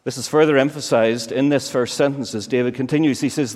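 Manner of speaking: normal talking voice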